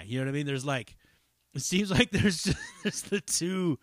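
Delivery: ordinary delivery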